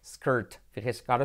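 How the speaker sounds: usual speaking voice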